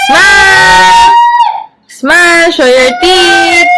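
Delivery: loud, high photo call. Real, not acted. Parent calls out to pose kids.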